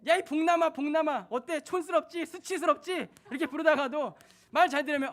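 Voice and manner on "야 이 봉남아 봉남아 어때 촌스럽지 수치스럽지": mock scolding. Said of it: "말을 안 들을 때는".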